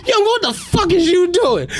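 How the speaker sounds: mock yell at kid.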